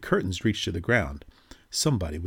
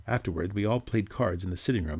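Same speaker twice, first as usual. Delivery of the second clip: usual speaking voice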